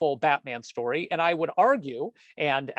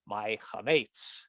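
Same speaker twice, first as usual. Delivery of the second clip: slow, low, softer groan of a punchline; mock lament; 6 semitones lower, 5 dB quieter, slower than usual. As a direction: drag it out like mourning a lost treasure. Punchline, husband mourning chametz.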